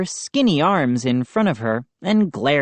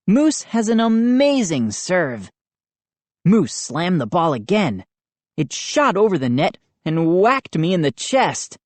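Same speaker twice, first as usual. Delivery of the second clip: ordinary delivery, nothing marked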